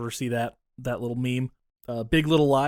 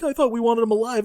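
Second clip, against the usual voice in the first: mock confused henchman; 9 semitones higher, 4 dB louder than usual.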